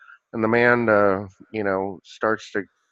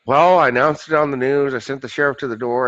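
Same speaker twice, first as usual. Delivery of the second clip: raised, mildly exasperated punchline; exasperated God; 4 semitones higher, 6 dB louder than usual. Acted. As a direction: list the efforts in acted exasperation. God answers in joke's punchline.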